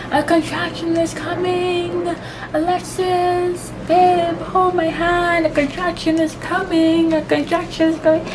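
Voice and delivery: high, stretched-out wail of dread; re-enacted labour panic. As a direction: call for help as the pain builds, pitched high. Re-enacts panicked plea during contractions.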